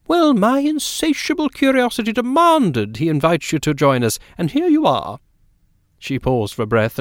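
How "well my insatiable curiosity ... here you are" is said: high, swooping lady's voice; Mrs Fortune, insistent hostess. Acted. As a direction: play the effusive hostess, pitched well up.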